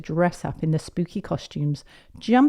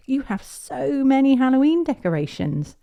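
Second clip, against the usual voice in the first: put-on high, wondering little-girl voice; amazed child; 8 semitones higher, 4 dB louder than usual. Peppa amazed by the decorations.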